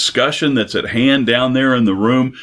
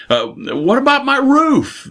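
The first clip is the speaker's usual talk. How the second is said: much higher, mock-fretful squeak; worried house owner; 14 semitones higher than usual. House owner asking the men about his roof, high mock-worried voice. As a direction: play the fretting owner, pitch way up. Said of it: "the house owner says".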